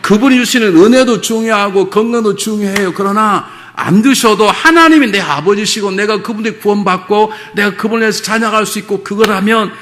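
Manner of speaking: loud, high, driving sermon voice; preaching at full force. Acted. Pastor urging the congregation to love God, loud and high. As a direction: press the point home to the whole church.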